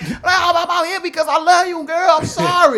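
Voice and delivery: high, loud hammed-up apology; mock pleading. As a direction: beg like a lovesick guy in the rain. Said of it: "it was like".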